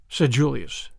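ordinary delivery

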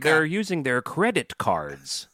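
slow, drawn-out fake American; mock American accent. Irish podcaster mocking American habits, slow mock American accent. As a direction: stretch out a bad American accent. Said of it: "an Irish person doing an American accent".

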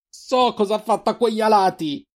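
high-pitched outraged shout; scream. A narrator's reading. Sister reveals she knows what he did, screaming. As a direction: cry it out high and accusing.

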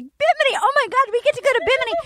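high, thrilled squeal at the dream trip; squealing excited girls. Retold or imitated. Host mocks contestants squealing over their Bimini trip, high excited squeal. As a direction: mimic the giddy contestants gushing. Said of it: "all the girls were like".

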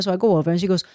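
normal talking voice

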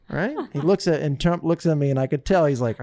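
ordinary delivery

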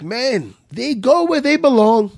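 put-on high, drawn-out accented sage; Renzo's accent. Student quotes his revered instructor, in the instructor's accent. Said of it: "the accent".

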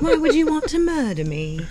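hammed-up British; mock British accent. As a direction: plead in posh British. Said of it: "who you sounded".

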